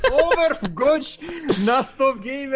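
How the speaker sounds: Overwatch stretched slowly, high and loud with a laugh; drawn-out hype. Acted. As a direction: drag out Overwatch like a showman. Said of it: "hyping the stream". (растянуто и восторженно)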